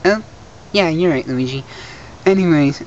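ordinary talk

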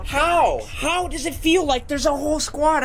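high-pitched, exasperated disbelief; exasperated protest. Real, not acted. Gamer baffled by a whole squad, exasperated protest.

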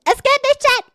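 high frustrated cry; loud, high protest; frustrated yell. Real, not acted. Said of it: "aber es geht nicht".

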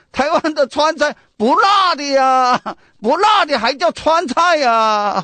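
hammed-up yell that the food isn't spicy; mock yelling. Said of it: "吼叫起来了". (夸张地模仿游客吼叫)

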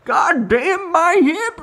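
mock Smurf grumbling about his hip; old Smurf griping. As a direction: whine like a creaky little Smurf. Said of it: "one's like".

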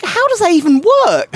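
high, loud incredulous question; baffled outburst. Retold or imitated. Gamer puzzling over an English actress's accent, loud baffled outburst. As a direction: blurt it out high in comic disbelief.

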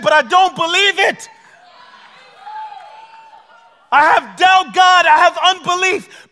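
a preacher voicing a desperate, hurting prayer; raw honest prayer. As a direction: pour out your hurt to God at full voice. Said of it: "This is what he wants from you".

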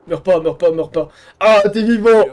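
loud urgent begging, then relieved shout; urgent pleading. Real, not acted. Gamer begging his companion not to die, then relieved shout.

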